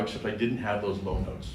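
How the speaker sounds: normal talking voice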